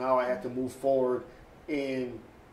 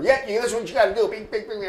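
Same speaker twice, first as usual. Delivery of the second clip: high, rapid-fire mock instructor; pushy beat photographer; 8 semitones higher, 5 dB louder, faster than usual. Beat photographer telling a student what to do, high rushed imitation. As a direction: rattle it out as an insistent newsman. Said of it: "like a beat photographer".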